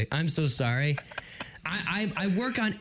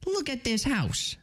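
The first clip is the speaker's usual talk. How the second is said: higher-pitched cartoon rabbit attempt; rough cartoon rabbit impression; Bugs Bunny; 4 semitones higher than usual. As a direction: pitch it up like a cartoon wise guy. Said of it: "I can't do Bugs Bunny".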